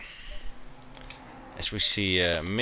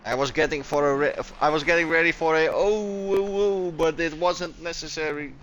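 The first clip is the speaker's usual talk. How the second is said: rising fast into high, startled "oh, oh"; alarmed excitement; 7 semitones higher than usual. Real, not acted. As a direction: brace for a crash, voice climbing higher and quicker. Bracing for a crash on track.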